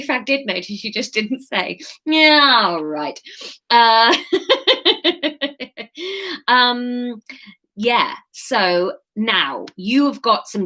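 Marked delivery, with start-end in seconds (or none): 4.2-6.4 giggle. Real, not acted.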